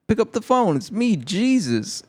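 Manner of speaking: a goofy high Jesus calling on the phone; mock Jesus voice. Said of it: "He's like".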